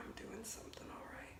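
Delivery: very soft, quick whisper; whispered self-reassurance. Real, not acted. Reminding herself it could be worse, whispering. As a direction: whisper it under your breath to yourself.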